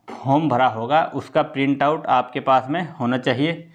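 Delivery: ordinary talk, nothing marked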